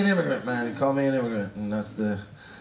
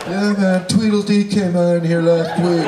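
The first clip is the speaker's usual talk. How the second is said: louder mock clerk voice; 6 dB louder than usual. Clerk mocking a customer called Tweedledee, loud. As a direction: keep the loud teasing clerk going.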